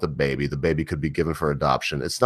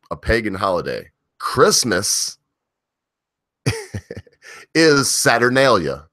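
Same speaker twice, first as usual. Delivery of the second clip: ordinary talk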